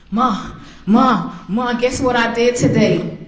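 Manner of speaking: imitated excited son. Son bounces into mother's room, imitated excited calling. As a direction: lightly act an excited teenager bursting with news.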